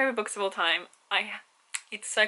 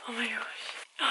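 softer whispered disbelief; stunned whisper; 8 dB quieter, slower than usual. Real, not acted. Opening a longed-for package, whispering in shock. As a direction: barely voice it, overwhelmed.

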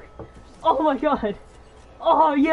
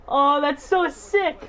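ordinary talk, nothing marked

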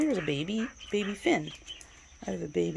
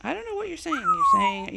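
high-pitched, exaggerated talk to a pet; 7 dB louder, faster than usual. Real, not acted. Owner answering the chattering bird, high-pitched pet talk. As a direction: squeal it fondly at the bird.

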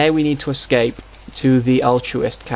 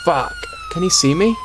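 startled squeak of fear; panicked yelp. Real, not acted. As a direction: voice jumps high in panic. Police car spotted.

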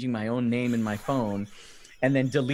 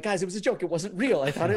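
high-pitched, mock-panicked explanation; retold pleading; 7 semitones higher, faster than usual. Teller messaging everyone that it was a joke, mock pleading. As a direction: plead innocence, voice raised in pitch. Retold frantic apology to friends.

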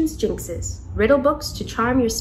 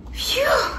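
high breathy sigh of relief; relieved phew; 12 semitones higher than usual. A narrator's reading. After counting the whole store, relieved phew. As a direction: blow it out, exhausted and relieved.

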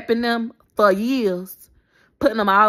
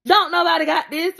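ordinary delivery

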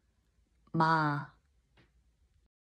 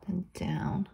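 whispered stroke instruction; 3 dB quieter than usual. Real, not acted. Teacher whispering the final stroke direction while writing. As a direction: drop to a whisper for the last stroke.

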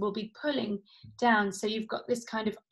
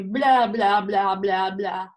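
loose floppy blah; 2 semitones lower, 6 dB louder, much slower than usual. Shown as a demonstration. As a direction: let the jaw flop, no tension. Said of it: "just go for me".

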